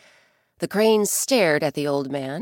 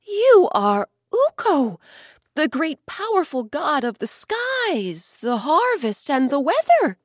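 much higher and louder awestruck bear; 8 semitones higher, 6 dB louder than usual. Acted. Bear in awe of the sky god, higher and louder. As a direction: exclaim in wonder.

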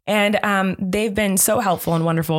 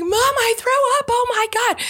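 put-on much higher, childlike voice of a frightened toddler; scared little boy. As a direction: squeak it out like a panicked small kid. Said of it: "He's like".

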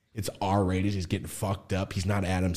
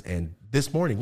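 ordinary delivery

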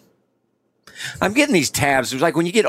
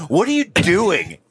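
Caleb; 9 semitones higher than usual. Retold or imitated. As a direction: imitate a friend's blunt, incredulous question. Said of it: "the first thing he said".

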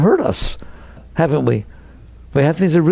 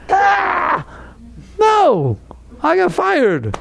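high, excited voice of a man re-living being fired; acted fired person; 14 semitones higher, much slower than usual. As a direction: play someone who lost a job and still cries out about it.

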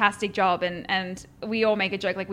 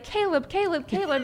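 a high, sing-song chant of the name; teasing friends calling out; 8 semitones higher than usual. Retold or imitated. Friends teasing about her TV dad, calling out the name. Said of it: "all of my friends would be like".

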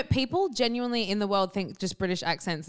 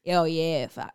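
put-on Australian accent; 4 semitones lower, slower than usual. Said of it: "hear an Australian accent in a film".

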